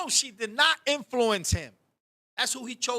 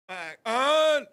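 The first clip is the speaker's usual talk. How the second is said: loud, higher mock grunt; imitated deaf man; 4 semitones higher, 5 dB louder than usual. Deaf Bear answering Barbara's no, imitated loud grunt. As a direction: push out a wordless questioning cry. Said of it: "this guy is deaf".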